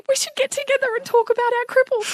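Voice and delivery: very high, quick, eager child imitation; excited son. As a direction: gush like a little boy who found a friend. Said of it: "He was so excited".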